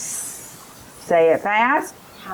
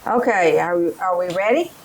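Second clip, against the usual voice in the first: ordinary delivery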